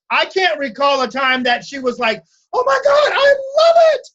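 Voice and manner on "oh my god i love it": imitated delighted client squeal, very high and louder; over the moon. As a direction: gush like a thrilled client, voice leaping way up. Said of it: "over the moon".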